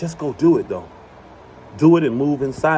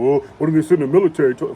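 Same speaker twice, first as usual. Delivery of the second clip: mocking quote; faster than usual. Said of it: "Then folks say something ridiculous".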